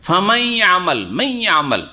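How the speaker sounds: ফামাই আমাল with n merged into a held nasal y; মাই আমাল, nasal trace of n on a doubled y; partial nasal merging. Shown as a demonstration. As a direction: hum the y through the nose in মাই আমাল. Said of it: "ইদগাম নাকেস".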